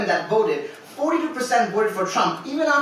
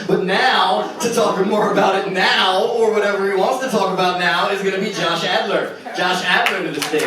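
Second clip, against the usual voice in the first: loud host introduction, calling the next poet up; booming stage intro; announcer; 6 dB louder than usual. Acted. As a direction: build it up like an emcee.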